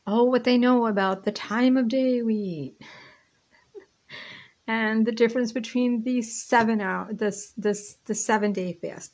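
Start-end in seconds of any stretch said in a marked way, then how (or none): 0.1-7.1 excited. Real, not acted. Enthusiastic exclamation.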